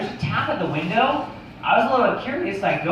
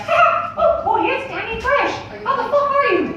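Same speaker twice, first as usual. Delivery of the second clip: very high squeaky cartoon voice; high-pitched cartoon mouse greeting; Mickey Mouse. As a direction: chirp it way up in falsetto. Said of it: "Hey, what's up, Mickey?".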